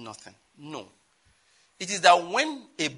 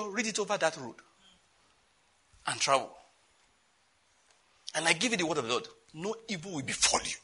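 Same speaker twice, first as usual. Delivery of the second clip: ordinary talk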